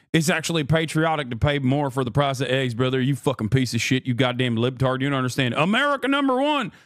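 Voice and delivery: mock angry patriot. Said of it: "some people are gonna turn around and say".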